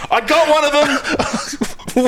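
high-pitched, building mock excitement; excited mock nerd. Host mocking a nerd spotting someone, excited high voice. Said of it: "some fucking nerd's like".

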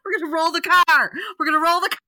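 high, loud retold panic; freaking out. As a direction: warn the driver in hammed-up terror.

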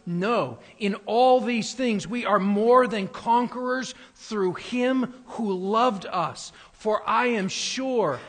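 loud, high, rising sermon climax; thundering preacher, slowing on the last words; preaching at full force. Acted. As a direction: build the Romans passage into a ringing proclamation. Reads Romans 8 building to climax.

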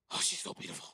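eerie whispered aside; unsettling whispered mutter; creepy whisper. Retold or imitated. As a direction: whisper like a man muttering to himself. Imitating date talking to himself.

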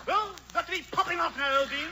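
high-pitched British toff Santa; breezy English goodbye; English Santa Claus. Acted. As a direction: play a hurried English Santa. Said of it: "English Santa Claus".

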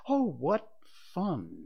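high, slow, drawn-out delight; slow high-pitched glee; forced merriment. A narrator's reading. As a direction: savour the joke, stretching the words. Father enjoys his own joke.

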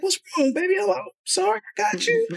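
very high baby-talk, retold; soothing a baby. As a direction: comfort a crying baby in a high little voice. Retold comforting of crying baby.

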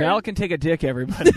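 laughing through the words. Real, not acted. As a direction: say it while cracking up. Laughing tease to everybody.

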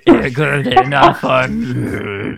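slow, lightly put-on Yoda croak; a hint of Yoda, dragged out slow. Podcast host mocks the film's Yoda, in a slow Yoda voice. Yoda impression after setup line.

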